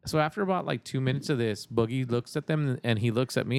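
ordinary delivery

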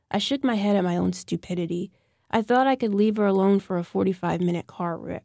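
ordinary delivery

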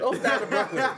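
repeats it through a laugh; laughing while saying it. Real, not acted. Friends joking about Brooklyn.

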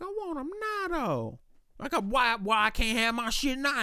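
put-on high, whining squeak of a spoilt kid; whiny inner voice. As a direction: whine it high like a kid denied a toy.

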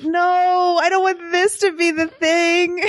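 high, half-crying cry of dismay; despairing wail. Retold or imitated. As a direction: wail it like your idol let you down. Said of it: "I was like".

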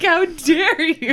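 slow, laughing mock indignation; amused fake offended; mock outrage. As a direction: drag it out, half laughing. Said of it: "Kerr would be like".

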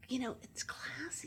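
slow, whispered aside; mock-confidential hush. Friend confiding why holiday is the classier word, hushed. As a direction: drop to a whisper as if sharing a refined secret.

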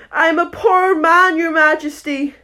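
high, loud, pitiful plea; pleading Hatter. Acted. Hatter kneels pleading before the king.

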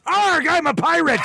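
high, slow hammed-up pirate. Joking to a friend in a mock pirate voice. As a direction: growl it out like a cartoon pirate.

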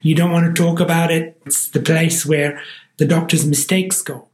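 ordinary talk, nothing marked

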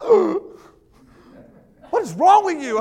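high, loud, drawn-out disbelief; hammed-up appalled dad; mock outrage. Mock outrage at disagreeing son.

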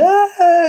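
long high-pitched "aah" of reluctant doubt; cri de réticence. Retold or imitated. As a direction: draw out a loud, high, squeaky "ah". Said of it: "où je me suis dit". (long « ah » aigu de réticence)

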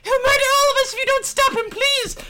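very high, shrieking, panicked scream; frantic scream. Acted. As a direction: shriek in terror at the top of your lungs. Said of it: "before screaming".

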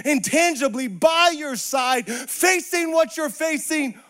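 driving, repetitive sermon cadence; impassioned preaching. Real, not acted. Preacher telling friends God is with us, fervent. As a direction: build to stretched, shouted key phrases.

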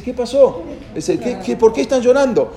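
high-pitched retold bewilderment; baffled questioning. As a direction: act out a puzzled onlooker asking for answers. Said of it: "Entonces él no entiende".

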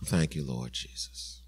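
soft, low worshipful thanks; quiet prayer. Real, not acted. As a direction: pray it softly and reverently.

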